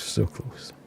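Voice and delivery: breathy whispered regret. Real, not acted. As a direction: whisper it to yourself, deflated. Near miss, repeated softly.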